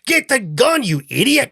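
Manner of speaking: high, loud mock yell at a henchman; hammed-up boss snapping at his goon; barked order. Donate snapping at Dick over the gun, high mock yell. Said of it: "he's like".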